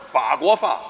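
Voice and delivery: 法国话 garbled as hua guo fa; swapped consonants. Acted. Teacher mispronouncing 法国话 to his student, consonants swapped. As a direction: swap the f and hu sounds in 法国话. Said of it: "你想说法国话吧".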